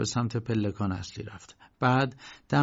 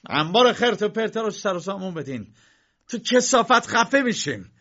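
loud, much higher angry shout; K yelling at his clerks; 9 semitones higher, 6 dB louder than usual. Acted. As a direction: bark the order at the clerks. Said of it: "کی داد زد".

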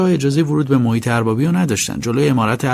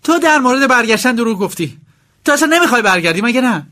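much higher, accusing wife's shriek; shrieking accusation; 12 semitones higher than usual. Acted. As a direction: hurl the accusation at him, shrill with anger. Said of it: "با جیغ پینکی مادام".

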